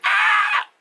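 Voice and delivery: very high, loud shriek; mock cry. Sister in the love scene, shrieking high. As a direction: let out a hammed-up cry. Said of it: "And she's like".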